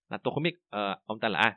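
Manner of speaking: ordinary delivery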